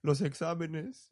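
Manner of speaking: slow, mildly tearful imitation of a sobbing teen; a touch of teary whimper, drawn out; sobbing girl imitated. Retelling a student crying over exams, mild mock sob. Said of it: "entre sollozos me dice".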